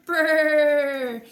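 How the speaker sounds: loud cold brrr through the teeth; shivering brrr. Real, not acted. Hand into ice water.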